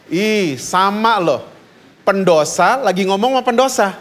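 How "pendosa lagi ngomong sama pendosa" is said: normal talking voice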